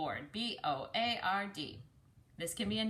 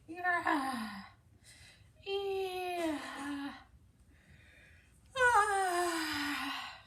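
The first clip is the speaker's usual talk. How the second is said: long, high yawning moos; cow yawns; 9 semitones higher, much slower than usual. Acted. Cows on the farm yawning from boredom, long and high. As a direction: stretch each yawn out like a sleepy cow.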